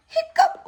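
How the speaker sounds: a sudden hiccup; high hiccup squeak. Acted. Mother acting the piglet's hiccup. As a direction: yelp the word up high.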